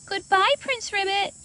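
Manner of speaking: high, loud farewell call; calling goodbye. A narrator's reading. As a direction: call out after the frog. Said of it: "called Camille".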